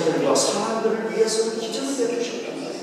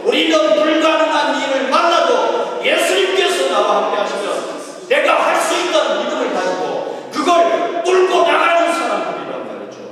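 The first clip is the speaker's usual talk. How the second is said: raised, loud sermon voice; fiery preaching; 7 semitones higher, 5 dB louder than usual. Acted. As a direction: preach it hard to the congregation. Preaching at full force.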